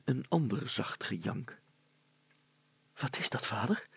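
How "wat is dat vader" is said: puzzled question. A narrator's reading. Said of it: "vroeg Hannes verbaasd".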